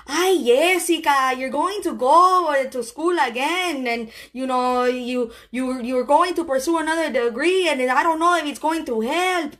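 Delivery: put-on doubtful mom with Spanish accent. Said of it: "in her Spanish accent that I love".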